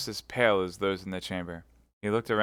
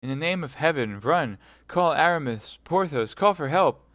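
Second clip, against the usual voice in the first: high, loud urgent commands; panicked shouting; frantic orders; 7 semitones higher, 5 dB louder than usual. Acted. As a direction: send them running. D'Artagnan frantic.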